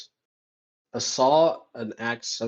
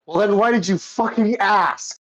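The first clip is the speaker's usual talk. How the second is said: louder, higher and drawn out; exasperated retort; 7 semitones higher, 3 dB louder, slower than usual. Acted. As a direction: snap back in mock exasperation. Acted bickering between two characters.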